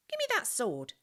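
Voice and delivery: put-on a cheeky street kid making a demand; pushy little boy snatching at a prize. A narrator's reading. Ragged boy demands the sword.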